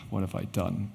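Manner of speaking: murmured to himself after a sigh; soft inner dread. Retold or imitated. Thinking after losing his biggest retailer, hushed self-reproach. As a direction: let the regret sink, hushed.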